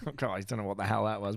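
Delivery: ordinary talk, nothing marked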